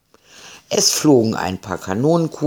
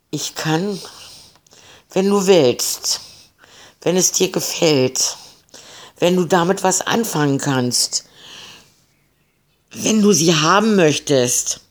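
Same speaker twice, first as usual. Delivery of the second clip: higher, nervous fearful offering; 6 semitones higher than usual. A narrator's reading. Frightened captain bargains with the pirate, nervous. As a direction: stammer out offers to please him. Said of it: "Die Angst des Kapitäns war deutlich zu spüren".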